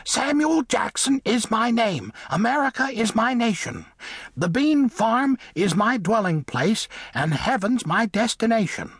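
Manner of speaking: high, singsong little mole recitation; reciting mole. A narrator's reading. Mole announces his name in verse, reciting. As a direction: recite it proudly in a high small voice. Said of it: "drew himself up and recited".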